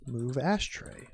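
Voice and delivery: ordinary delivery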